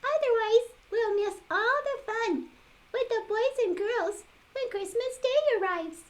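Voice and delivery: sing-song high toy doll voice. Acted. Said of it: "said the misfit doll".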